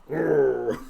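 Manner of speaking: playful knowing "Oh"; sly winking aside. Acted. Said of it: "he gives a little wink and then goes".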